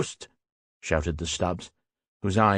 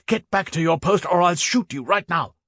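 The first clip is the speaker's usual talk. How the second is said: high, raised threatening order; shouted threat; 9 semitones higher than usual. A narrator's reading. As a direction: force him back to his post. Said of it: "shouted the Stubbs".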